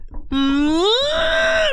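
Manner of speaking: loud, very high closed-mouth squeal; energetic squeal. Retold or imitated. Stoner tapping the table, squealing with energy. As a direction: let pent-up energy burst out high. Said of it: "so energetic".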